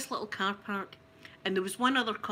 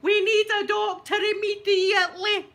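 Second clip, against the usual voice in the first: high, loud panicked yell through laughter; shouting; 10 semitones higher, 7 dB louder than usual. Retold or imitated. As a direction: yell for help in hammed-up panic.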